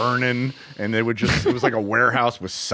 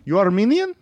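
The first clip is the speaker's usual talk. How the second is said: put-on high, suspicious Persian-accented question; Persian guy; 8 semitones higher, 3 dB louder than usual. Persian worker checking before helping, imitated Persian accent. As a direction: imitate a Persian man checking your background. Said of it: "everyone was Persian".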